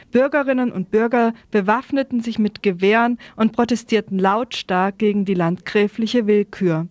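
ordinary talk

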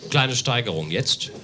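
normal talking voice

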